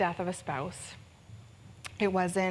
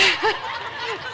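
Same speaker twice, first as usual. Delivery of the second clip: laughing; 13 semitones higher, 3 dB louder than usual. Real, not acted. Laughing at own joke.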